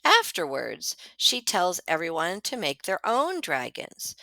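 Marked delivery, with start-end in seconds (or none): none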